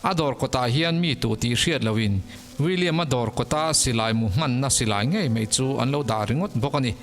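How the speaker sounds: ordinary talk, nothing marked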